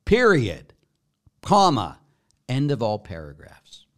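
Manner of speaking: punched out, one word at a time; emphatic finality. Real, not acted. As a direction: slam each word down as the final verdict. Emphatic finish to his rant.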